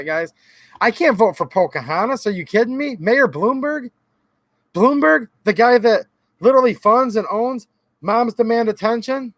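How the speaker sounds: ordinary talk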